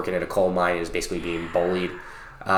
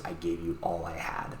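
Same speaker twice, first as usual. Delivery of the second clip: faint, raspy, much softer; weak raspy voice; 3 semitones lower, 12 dB quieter than usual. Retold or imitated. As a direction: barely get it out, weak and rasping. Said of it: "in a very weak, raspy voice just says".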